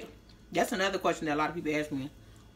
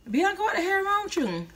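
much higher mock voice of the startled boyfriend; imitating boyfriend; 12 semitones higher, 4 dB louder than usual. Boyfriend on the phone reacting to her screaming, high alarmed mock voice. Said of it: "he was like".

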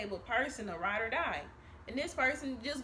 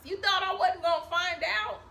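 mock accusing; 7 semitones higher, 6 dB louder than usual. Acted accusation.

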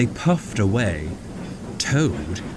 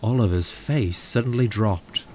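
usual speaking voice

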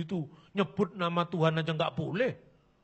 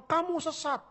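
mock accusation; 10 semitones higher than usual. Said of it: "Anda katakan".

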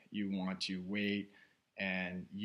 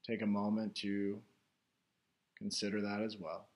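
guided meditation; 3 semitones higher, 5 dB louder than usual. Real, not acted. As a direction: guide listeners inward, unhurried, phrase by phrase. Leads a guided meditation after chat.

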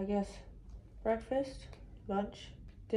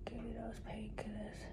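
fast, soft whispered begging; pleading whisper; 3 semitones higher, 11 dB quieter than usual. Real, not acted. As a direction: beg quietly for the drugs. Pleading for pain relief post-surgery.

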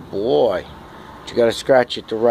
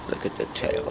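whispered; 9 dB quieter than usual. Real, not acted. Quietly pointing out cat's tail.

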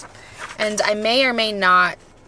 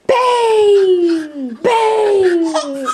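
much higher, loud laughing shout; playful bang shout; 11 semitones higher, 8 dB louder than usual. Real, not acted. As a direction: fire a mock gunshot at the dog, laughing. Play-shooting the dog for trick.

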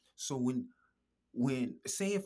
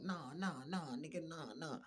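softer, mocking brush-off; mocking dismissal of blame, softer; mock dismissive; 2 semitones higher, 7 dB quieter than usual. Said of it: "I'm not gonna fucking act like".

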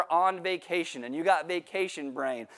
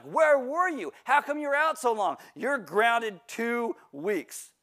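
stern mom; 6 semitones higher, slower than usual. Retold or imitated. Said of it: "she's like".

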